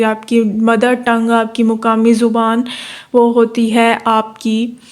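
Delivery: ordinary delivery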